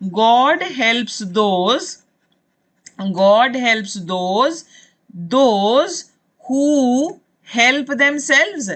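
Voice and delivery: extremely slow, word by word; teacher dictating a proverb very slowly; slow proverb dictation. Shown as a demonstration. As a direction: drag out each word of the proverb for the class. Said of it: "एक और कहावत है, वो आपको बता देती हूँ".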